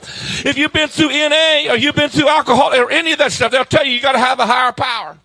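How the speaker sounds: loud, driving sermon delivery; big pulpit voice; full-force preaching. Real, not acted. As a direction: preach it hard to the whole room.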